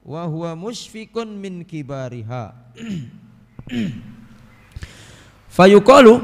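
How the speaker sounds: usual speaking voice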